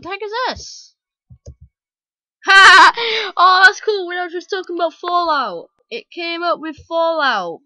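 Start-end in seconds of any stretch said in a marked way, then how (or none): 2.4-7.6 loud, high, excited outburst; delighted surprise. Real, not acted. Player finds a Fallout Easter egg, thrilled shout. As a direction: burst out thrilled at the discovery.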